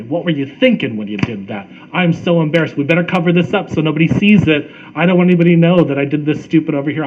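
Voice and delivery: loud, harried self-reproach; hammed-up demon on the shoulder, berating and fretting; scolding inner critic. Critical inner voice berating oneself over a mistake, scolding. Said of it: "The other voice is".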